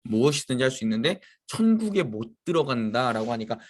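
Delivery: usual speaking voice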